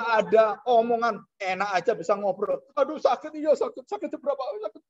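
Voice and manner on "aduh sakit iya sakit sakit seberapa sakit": high, whiny cries of pain; lone hell dweller whining about the pain, mock agony; mock pained moaning. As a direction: ham up a soul groaning in hell.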